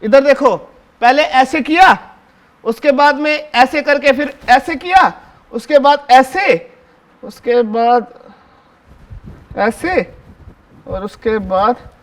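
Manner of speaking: slow, step-by-step teaching voice; slow demonstration. Shown as a demonstration. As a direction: spell out each step like showing a kid. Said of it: "देखो और सीखो कैसे लगाते tie".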